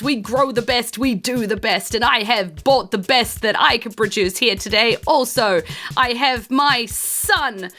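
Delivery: high, proud speech; boastful rival father. Acted. Rival father brags about his son, high and proud. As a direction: brag to the crowd. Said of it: "he says that".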